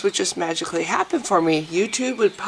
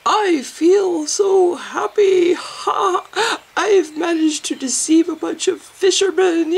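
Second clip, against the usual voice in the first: high, loud, slow puppet voice, gloating; 10 semitones higher, 4 dB louder, slower than usual. Acted. Puppet bragging about the fishermen, high and gleeful. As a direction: crow over the fooled fishermen. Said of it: "so I suck at puppets".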